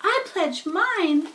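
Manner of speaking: high, slow tiny voice; name herself proudly; little spider voice. A narrator's reading. First spider names herself to Wilbur, high and slow. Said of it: "said Joy".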